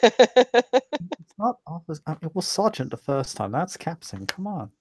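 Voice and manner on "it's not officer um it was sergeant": slow, high, put-on correction; haughty correction. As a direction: correct her with pompous slowness.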